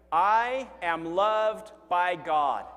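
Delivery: slow, loud unison declaration; slow group recitation. Shown as a demonstration. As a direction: read it out together slowly and firmly. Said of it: "Let's read 'em together".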